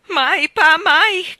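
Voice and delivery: high, loud tearful pleading; between sobs. A narrator's reading. Mrs Taylor sobbing to calm her husband, high and loud. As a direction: cry out the denial. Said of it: "confermò la signora Taylor tra le lacrime".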